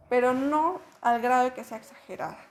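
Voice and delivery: ordinary talk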